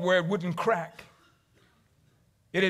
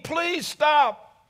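mock scream; 8 semitones higher, 3 dB louder than usual. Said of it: "and I screamed".

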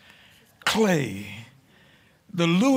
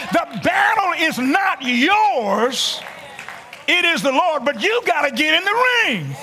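preaching at full force; 6 semitones higher than usual. Acted. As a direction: build the call to a shout. Preaching climax.

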